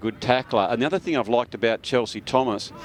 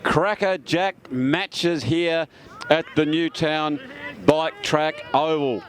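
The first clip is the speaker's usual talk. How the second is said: raised pitch with drawn-out key phrases; sports announcer scene-setting; 6 semitones higher than usual. Real, not acted. Commentator introducing the last quarter of football, raised announcer delivery. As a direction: set up the final quarter in a high announcer voice.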